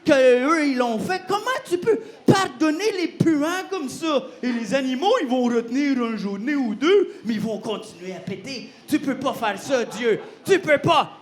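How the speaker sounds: mock-furious rant at God; angry at God. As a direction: argue hotly like a sulky prophet. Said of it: "il se fâche contre Dieu".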